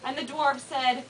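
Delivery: usual speaking voice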